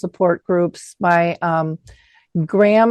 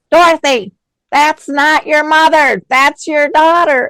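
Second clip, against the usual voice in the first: loud, high scolding call across the room; yelling out; 8 semitones higher, 6 dB louder than usual. Retold or imitated. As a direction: call out sharply to correct her. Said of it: "she would yell out".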